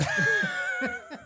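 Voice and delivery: long, very high squealing laugh; helpless high cackle; high-pitched laugh. Real, not acted. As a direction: burst into a high, falling laugh. Laughs after kiss joke.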